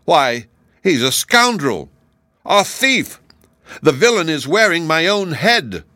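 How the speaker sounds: loud, high outrage; indignant, heated accusation; provoked shouting. A narrator's reading. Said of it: "shouted the Tin Soldier, now very much provoked".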